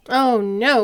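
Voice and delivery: ordinary delivery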